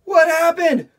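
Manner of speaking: high, loud panicked cries; alarmed animals. Acted. Forest animals panicking at a strange noise, high and loud. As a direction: gasp out in sudden alarm.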